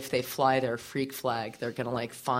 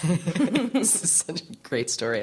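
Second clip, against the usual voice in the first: ordinary talk, nothing marked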